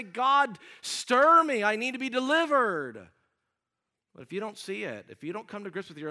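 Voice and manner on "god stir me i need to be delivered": loud, high plea to God; crying out. Acted. Humbled sinner asks God for deliverance, crying out. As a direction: cry out urgently from the pulpit. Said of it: "cry out and say".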